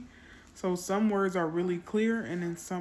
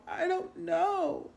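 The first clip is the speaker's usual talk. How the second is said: much higher, slow teary whine; whining child; 12 semitones higher, slower than usual. Retold or imitated. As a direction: whimper it like a crying little girl. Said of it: "continued crying and whining".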